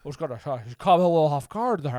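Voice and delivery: stammering old movie star impression; Jimmy Stewart. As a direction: hem and haw like an old Hollywood actor.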